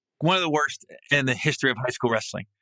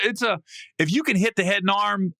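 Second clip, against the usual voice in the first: ordinary talk, nothing marked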